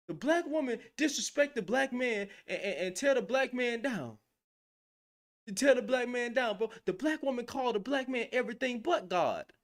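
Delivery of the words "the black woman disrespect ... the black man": raised, mocking Hotep preacher voice; imitating Hotep men complaining about women; mock Hotep talk. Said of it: "They say the most dumb stuff".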